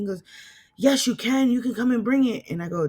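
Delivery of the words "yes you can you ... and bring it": high-pitched, emphatic contradiction; insistent retort. Retold or imitated. Dad insisting his daughter bring his things, insistent retort. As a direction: imitate someone stubbornly insisting. Dad's insistent reply, acted.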